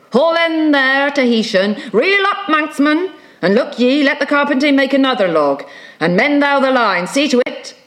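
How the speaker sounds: high, urgent captain's orders; commanding. Acted. Ahab ordering crew to mend the line, high commands.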